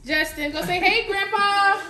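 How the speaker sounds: high, loud, drawn-out prompt. Real, not acted. Mother urging Justin to greet Grandpa, brightly coaxing. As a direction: sing it out slowly for a little one.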